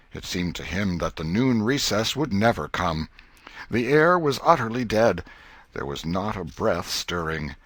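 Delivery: plain reading voice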